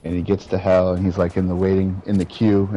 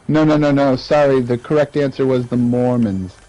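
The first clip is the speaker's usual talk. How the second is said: mock-official correction, pitched up and firm; condescending; 3 semitones higher, 4 dB louder than usual. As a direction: break it to them like a patronizing quiz host. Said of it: "the dude just condescendingly goes".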